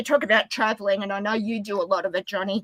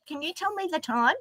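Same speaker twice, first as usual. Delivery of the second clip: soft, higher voice of a curious stranger; imitated eager questioner; 6 semitones higher, 8 dB quieter than usual. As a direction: lightly mimic someone asking sweetly. Voices people asking to hear accent.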